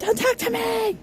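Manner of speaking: very high mock shout; mocking quote. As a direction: squeal it like a sulky kid.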